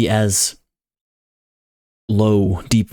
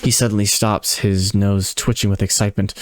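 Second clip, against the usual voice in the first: usual speaking voice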